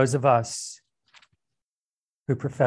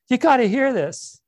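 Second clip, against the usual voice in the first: much higher, louder eager burst; giddy friend sharing big news, higher and louder; excited blurt; 8 semitones higher, 4 dB louder than usual. Acted. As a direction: blurt it out like news that can't wait.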